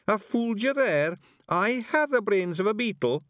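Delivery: high, loud Scottish pictsie chuckling; a daft Feegle laughing at his own joke; proud Feegle laugh. Acted.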